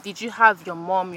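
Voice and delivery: ordinary talk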